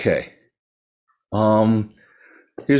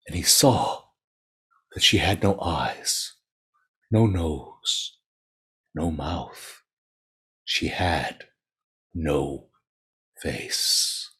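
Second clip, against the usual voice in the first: much lower and softer, slowing to a whisper; hushed reveal; 7 semitones lower, 6 dB quieter, much slower than usual. A narrator's reading. Narrator hushes as the faceless ghost is revealed, soft slow dread. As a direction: drop the voice for the horror reveal.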